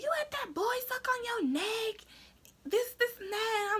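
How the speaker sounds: dad's voice. Retold or imitated. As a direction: mimic dad's stunned question. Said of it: "So he's like".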